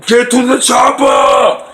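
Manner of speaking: loud, slow, high barked order; Arnold shout. Retold or imitated. Arnold ordering everyone to the chopper, a loud slow shout. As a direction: bellow it like an action hero. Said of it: "Arnold Schwarzenegger, when he said".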